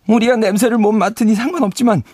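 scarecrow voice. Acted.

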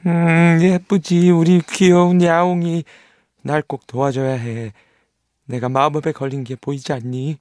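put-on high, loud wheedling child; sugary begging boy; pleading boy. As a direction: coax the cat sweetly. Nils pleads with cat.

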